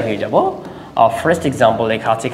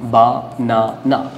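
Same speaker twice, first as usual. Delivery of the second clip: "বানানা" slowly, as three separate beats বা, না, না; split into syllables; much slower than usual. Shown as a demonstration. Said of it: "তাহলে এখানে কয়টা".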